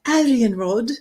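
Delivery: playful mock British accent; hammed-up British "Aryan Rod". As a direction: put on a British accent for the name. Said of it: "that was with a British accent".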